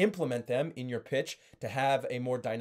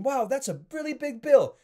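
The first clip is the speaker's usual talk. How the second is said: much higher, louder overexcited bill shock; 11 semitones higher, 5 dB louder than usual. Shown as a demonstration. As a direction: gush about the bill at the top of your range. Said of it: "when you're way up here".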